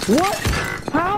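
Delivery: sharp high startled what; surprised. Real, not acted. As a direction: jolt of disbelief. Sudden shock in game.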